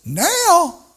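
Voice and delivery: a shocked little "Now?"; mock astonished church lady; surprised.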